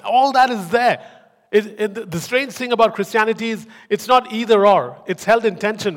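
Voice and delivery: loud, high preaching; preaching at full force. Acted. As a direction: preach it out with fire.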